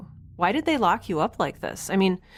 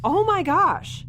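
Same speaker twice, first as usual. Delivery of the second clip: shocked gasp; 10 semitones higher, 3 dB louder, slower than usual. Acted. Sees the leg wound.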